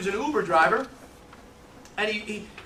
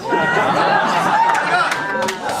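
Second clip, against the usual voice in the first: big laugh; 6 dB louder than usual. Real, not acted. Laughing at his own story.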